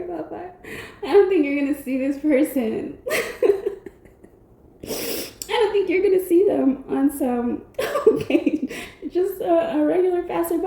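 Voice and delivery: breaks into giggles between words; trying to get the sentence out through laughter; laughing while talking. Real, not acted. Apologizing to the viewer while still laughing. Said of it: "I'm not laughing at you".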